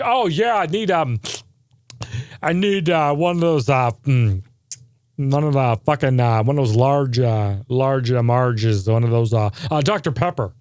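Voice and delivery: hesitant, dithering order full of ums; a lightly voiced dithering customer; fumbling customer. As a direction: play a clueless customer stalling at the speaker box. Same bumbling customer ordering drink.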